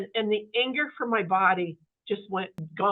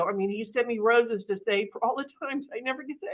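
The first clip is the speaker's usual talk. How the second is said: ordinary delivery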